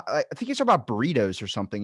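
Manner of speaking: ordinary talk, nothing marked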